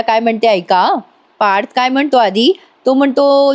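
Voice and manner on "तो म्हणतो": ordinary delivery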